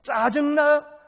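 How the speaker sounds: loud, high shouted question; challenging shout. Acted. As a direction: fire the question at the congregation. Acted question-and-answer with congregation.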